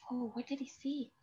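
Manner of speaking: ordinary talk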